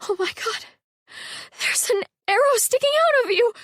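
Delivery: high, alarmed cry at the arrow; shocked gasp. A narrator's reading. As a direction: blurt it out in alarm, pitch shooting up. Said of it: "Halla gasped".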